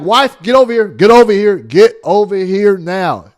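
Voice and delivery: commanding call to wife. Retold or imitated. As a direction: summon the wife loudly before she slips away. Calling wife back after church.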